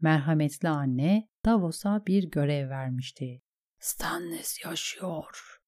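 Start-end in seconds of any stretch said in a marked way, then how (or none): 3.8-5.6 slow, very soft whisper; inner realization. A narrator's reading. Davos realizes his king lives, whispering. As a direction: whisper it to yourself as it sinks in. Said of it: "Davos biliyordu artık".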